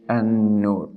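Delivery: "an-nur" with a held nasal n; ghunna. Shown as a demonstration. Teacher recapping the rule, "an-nur" with nasal held n. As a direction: hum on the doubled n of "an-nur". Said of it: "with ghunna".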